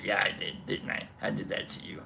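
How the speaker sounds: low smug whispered voicing. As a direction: whisper it back, pleased with yourself. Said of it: "I was like".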